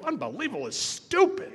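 loud, high exasperated outburst; scornful retort. Retold or imitated. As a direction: throw it back at him, voice leaping up. Acted scornful retort to brother.

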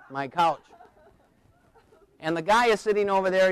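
ordinary delivery

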